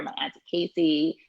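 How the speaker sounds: usual speaking voice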